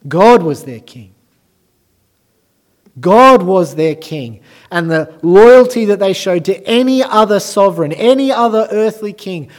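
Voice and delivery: loud, high, emphatic preaching; preaching at full force. Acted. Preacher pressing the congregation about the chief priests, loud and high. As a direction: drive each phrase home from the pulpit.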